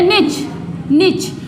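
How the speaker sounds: "निच" with short i and final ch; "निच" rhyming with rich; American pronunciation. Shown as a demonstration. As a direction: say "निच" as nitch. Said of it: "American English में बोलते हैं".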